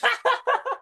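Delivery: squealing, high laughter; high guard laugh. Acted. Guards crack up at the prisoner, squealing with laughter. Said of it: "both the guys start laughing".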